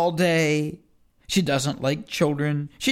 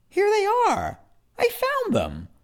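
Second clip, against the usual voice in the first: much higher, delighted; excited discovery; 14 semitones higher than usual. Acted. Boy finding his pants, much higher and delighted. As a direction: squeal at finding them.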